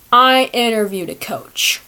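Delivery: ordinary talk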